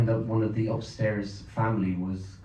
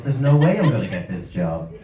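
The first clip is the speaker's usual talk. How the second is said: louder, comic dismay at a lost chance; mock horror; 3 semitones higher, 7 dB louder than usual. Actor recalling his audition to an audience, mock horror. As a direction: retell the panic for laughs. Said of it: "I was horrified".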